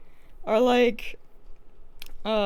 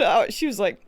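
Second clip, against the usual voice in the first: usual speaking voice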